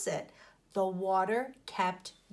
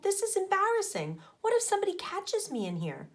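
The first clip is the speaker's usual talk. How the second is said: put-on high, fast flustered boy; flustered Arnold; 11 semitones higher than usual. A narrator's reading. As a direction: blurt it out nervously, voice jumping up. Arnold embarrassed in girls' bathroom.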